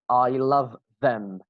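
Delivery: slow, each word clear; slow careful reading. Shown as a demonstration. Teacher reading the example slowly to students. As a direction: read it word by word.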